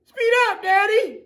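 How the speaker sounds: put-on high, loud kid begging; excited kid. Little kid on dad's motorcycle, high excited squeal. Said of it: "and I said".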